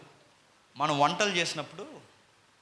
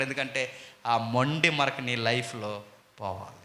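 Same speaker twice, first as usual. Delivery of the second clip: usual speaking voice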